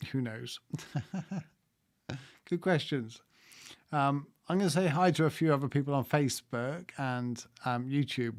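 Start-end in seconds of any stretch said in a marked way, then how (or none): none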